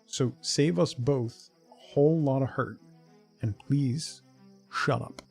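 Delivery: ordinary talk, nothing marked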